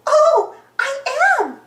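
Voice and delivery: high, loud cartoon Oh; acted happy surprise; delighted realization. A narrator's reading. Cuckoo Loca realizes, delighted.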